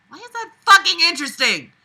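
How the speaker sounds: indignant outburst; exasperated rant. Real, not acted. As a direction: vent your disbelief. Angry rant at the book.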